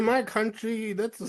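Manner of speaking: hammed-up Russian man voice; Russian accent. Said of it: "Your freaking Russian accent".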